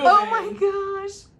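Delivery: real high-pitched squeal of joy; excited squeal. Real, not acted. Moved by the ultrasound.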